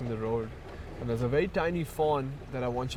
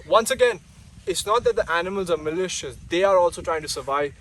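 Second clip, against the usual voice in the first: raised, louder and higher plea; impassioned; 5 semitones higher, 6 dB louder than usual. Real, not acted. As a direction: push it out with conviction.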